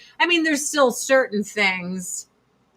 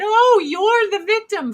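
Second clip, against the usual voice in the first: high, loud mock sympathy; sarcastic, pitched up and pushed; 10 semitones higher, 5 dB louder than usual. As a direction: jeer it in a raised, exaggerated voice. Mocks people claiming victimhood.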